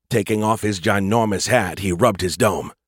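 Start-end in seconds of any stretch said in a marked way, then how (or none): none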